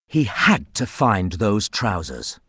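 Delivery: ordinary delivery, nothing marked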